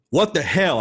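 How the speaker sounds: loud, high retold outburst; blurted retort. Idol answering a lunch invitation, loud sudden blurt. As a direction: blurt it out after a pause.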